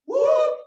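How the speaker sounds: very high shouted whoop; big crowd whoop. Shown as a demonstration. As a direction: let out a sharp high whoop. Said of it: "here's the yell".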